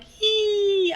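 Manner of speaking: high-pitched made-up gecko squeal; gecko noise. Pretend. Co-host guesses at gecko army's noise, high mock squeal. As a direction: squeak like a tiny hell gecko. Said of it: "what noise a gecko makes".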